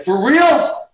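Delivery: high-pitched incredulous check; retold disbelief. Retelling Gary hearing of rehearsal, high-pitched disbelief. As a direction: ask it high, hardly believing it.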